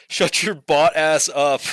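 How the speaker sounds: laughing while teasing. Real, not acted. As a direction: laugh through the insult. Laughing insult at chat.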